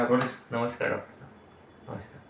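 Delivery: bow low with a mild humble namaste; humble greeting. Retold or imitated. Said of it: "अंदर बाहर अलग है". (Doctor के सामने धीमा, विनम्र नमस्कार)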